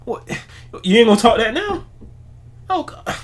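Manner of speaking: high mock panic from a caught reporter; mock flustered reporter. Imitating a reporter squirming under Coach Prime's question, high. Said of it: "he call you out".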